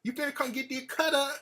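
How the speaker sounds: lightly voiced country girlfriend; country girl voice. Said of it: "talk that country fucking shit".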